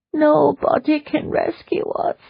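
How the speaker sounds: slow tearful high voice; crying a little as she gives up; sad. Acted. Annie tells Leona rescue is hopeless, tearful. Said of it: "Annie explained sadly".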